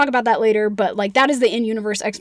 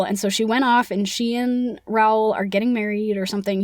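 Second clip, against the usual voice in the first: ordinary talk, nothing marked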